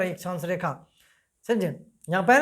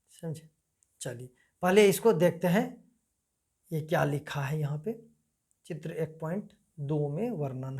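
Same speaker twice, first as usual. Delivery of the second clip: muttering to himself; 6 semitones lower, 9 dB quieter than usual. Real, not acted. Searching the book, talking to himself.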